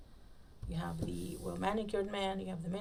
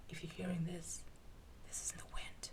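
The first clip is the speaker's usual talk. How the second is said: hushed unvoiced ghost-story whisper; spooky whisper; 9 dB quieter than usual. Real, not acted. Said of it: "No, I'm just kidding".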